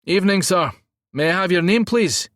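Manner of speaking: high, loud Scottish gatekeeper; thick Scottish accent. A narrator's reading. Security man greets driver at gate, thick Scottish accent. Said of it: "A thick Scottish accent".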